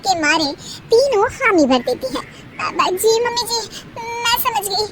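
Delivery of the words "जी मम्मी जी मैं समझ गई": meek daughters-in-law. Acted. Daughters-in-law agree meekly.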